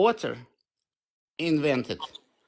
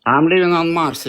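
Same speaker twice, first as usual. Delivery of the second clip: louder, mocking brush-off; sarcastic retort; 9 dB louder than usual. Real, not acted. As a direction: throw the question back with sarcasm. Sarcastic reply to questioning.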